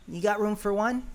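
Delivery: a shy request; Greeble asking. Acted.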